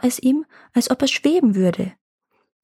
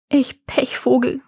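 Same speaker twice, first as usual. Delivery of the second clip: higher, slow mild lament; exclaimed self-pity; 4 semitones higher than usual. A narrator's reading. Farmer laments being stuck on the ice, mildly self-pitying. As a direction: draw out a mild wail of bad luck. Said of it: "rief der Bauer".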